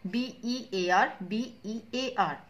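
slow, letter by letter; careful dictation pace; spelling out letters. Shown as a demonstration. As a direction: spell each letter out slowly for the class. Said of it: "spelling same है".

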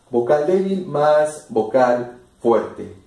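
fuerte hit hardest at the end of "Vocal débil más vocal fuerte."; stress on fuerte. Shown as a demonstration. Teacher shows the class where the force goes, stressing fuerte. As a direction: lean on the word fuerte like a teacher. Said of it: "Aquí está la fuerza de la voz".

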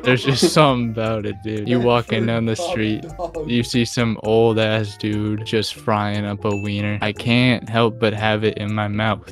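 ordinary talk

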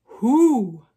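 loud, hooting question; bear asking. A narrator's reading. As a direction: boom the question out like a storybook bear. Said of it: "And the bear asks".

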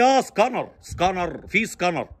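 سكانر as "ska-nar" with broad a and r; Arabised scanner; wrong pronunciation. Retold or imitated. Friend finally understanding the request, wrong pronunciation. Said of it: "مفيش حاجة اسمها سكانر".

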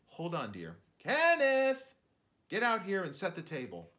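loud call to the other room. Acted. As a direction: shout for the boy, high and loud. Mom calling son from kitchen.

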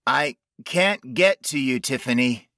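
mildly strained call from a distance; calling back. A narrator's reading. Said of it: "he called back".

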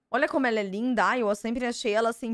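ordinary talk

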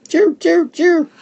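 very high, loud bird chirping; tiny sparrows calling out high and bright; sparrow chirps. A narrator's reading. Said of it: "the sparrows called".

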